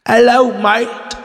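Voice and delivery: slow, high Cockney-style hello mate; mock British accent. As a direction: play a cheeky Brit. Mocking each other.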